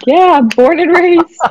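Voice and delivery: high, slow, emphatic comeback; playful mock retort. Guest answers hometown folks doubting their roots, playfully emphatic. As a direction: push it up high and draw it out.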